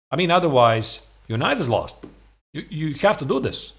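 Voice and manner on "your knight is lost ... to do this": ordinary talk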